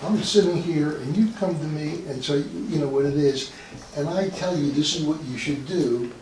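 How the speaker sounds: usual speaking voice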